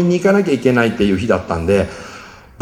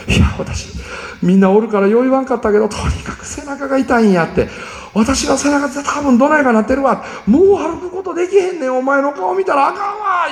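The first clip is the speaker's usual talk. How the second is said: 1 pained collapse; 13 semitones higher than usual. Retold or imitated. Mother collapsing from back pain.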